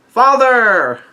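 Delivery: high, drawn-out mock cry; retold yell. Pirates yell out to their leader, high mock cry. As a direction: call out in imitation, pitched well up. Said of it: "all the fire tank powers yell out".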